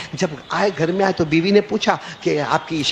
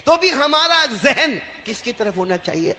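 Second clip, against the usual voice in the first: rousing question; 8 semitones higher than usual. Real, not acted. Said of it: "Allah".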